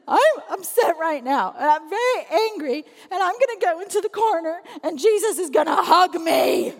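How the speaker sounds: hammed-up child tantrum, high and loud; upset little kid. Upset child announcing a trip to the corner, mock wailing. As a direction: wail it like an overwhelmed preschooler.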